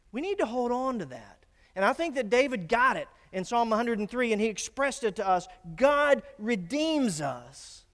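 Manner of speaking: loud, high sermon voice; full-force preaching. Real, not acted. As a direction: proclaim it to the congregation, stretching the last words.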